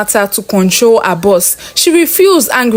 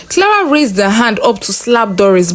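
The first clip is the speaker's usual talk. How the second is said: plain reading voice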